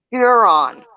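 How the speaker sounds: 'Huron' as 'sure' with an H in front; model pronunciation. Shown as a demonstration. Co-host teaching Penny the lake's name, model pronunciation. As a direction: show how 'Huron' starts with H, American r. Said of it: "put an H in front of it".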